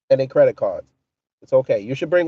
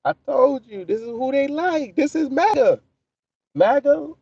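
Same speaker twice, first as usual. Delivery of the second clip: excited mocking; 8 semitones higher than usual. Real, not acted. Mocking outburst to Leonard.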